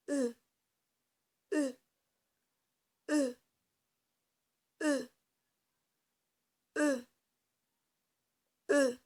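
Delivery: E pushed out with a puff of breath, mouth barely open; breathy E. Shown as a demonstration. Teacher showing children how to blow out the E. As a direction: blow the E out gently. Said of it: "comme je souffle".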